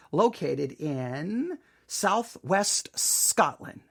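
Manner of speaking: slow, drawn-out announcement; pointedly slow. Real, not acted. Host owns up to his accent mistake, pointedly slow. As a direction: stretch each word to make the point. Pointedly spelling out the corrected location.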